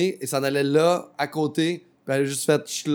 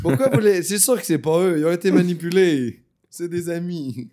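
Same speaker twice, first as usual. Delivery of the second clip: mock naive defender; 5 semitones higher, 7 dB louder than usual.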